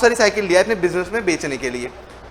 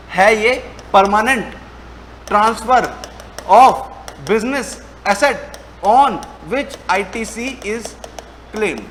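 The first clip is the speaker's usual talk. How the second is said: slow dictation; much slower than usual. Shown as a demonstration. Teacher dictating the heading.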